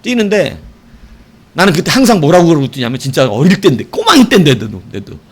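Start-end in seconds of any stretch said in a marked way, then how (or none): none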